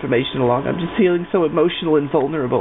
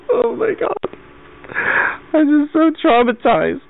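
fake crying; 10 semitones higher than usual. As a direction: wail it out as a badly faked breakdown. Caller fakes breaking down to receptionist.

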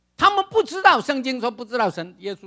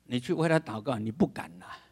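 ordinary talk

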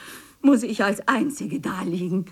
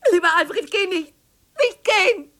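high, louder begging cry; panicked pleading as he leaves; desperate plea; 8 semitones higher, 4 dB louder than usual. Acted. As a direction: beg him not to walk out. Begging husband not to leave.

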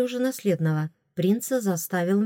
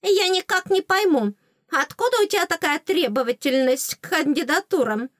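high fairy voice, reproachful; puzzled fairy; 9 semitones higher, 4 dB louder than usual. Acted. Fairy questions heroine's pickiness, high reproach. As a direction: question her in a high little voice.

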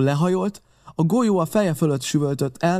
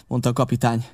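ordinary delivery, nothing marked

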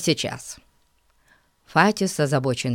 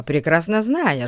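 ordinary delivery

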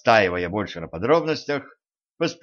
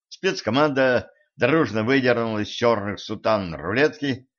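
plain reading voice

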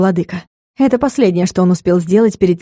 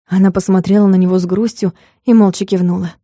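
ordinary delivery, nothing marked

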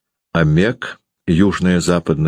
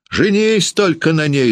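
jealous wife screaming at her husband; 13 semitones higher than usual. Acted. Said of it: "закричала Варвара".

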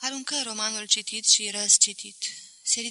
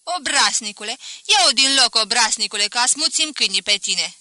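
put-on old spinster yells at the former servant, loud and angry; shouting; 9 dB louder than usual. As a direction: chase him off with a shout. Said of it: "se dezmetici și-mi strigă".

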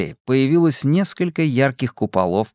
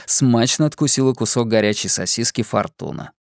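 ordinary delivery, nothing marked

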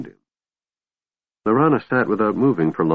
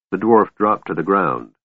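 ordinary delivery

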